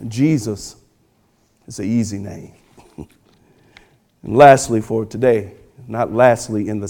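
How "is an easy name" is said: usual speaking voice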